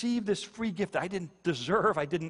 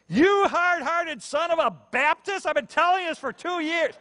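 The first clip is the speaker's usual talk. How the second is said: mock furious wife; 14 semitones higher, 8 dB louder than usual. Wife pretends to scold her newly converted husband, mock furious yell. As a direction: jokingly explode at him, loud and high. Joke outburst of wife, then "I'm kidding.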